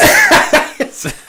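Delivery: a squeal of laughter, high and bright. Real, not acted. Friend squealing with laughter at the Godzilla joke, high laugh. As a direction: shriek with laughter.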